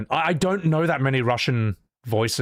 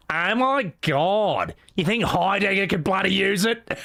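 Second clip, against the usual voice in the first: Scarlet; 7 semitones higher than usual. Acted. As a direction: ham up a bad Australian accent. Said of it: "her being a horrible Australian".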